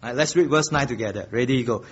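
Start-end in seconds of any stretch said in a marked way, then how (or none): none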